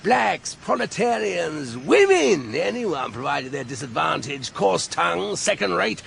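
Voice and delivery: mocking speech in a Marlborough accent; lightly mimicked Marlborough accent; Marlborough accent drawl. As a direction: parody a Marlborough idealist's drawl. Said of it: "he drawled in his best Marlborough accent".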